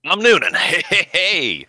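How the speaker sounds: high laughing hey hey; delighted recognition. Real, not acted. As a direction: cheer the name like spotting a friend.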